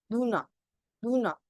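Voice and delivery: दूना with the first N sounding like D; denasalized demo. Shown as a demonstration. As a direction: model दूना as an isolated word, D-like onset. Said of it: "first पे आएगा सिर्फ तभी ऐसे द सुनाई".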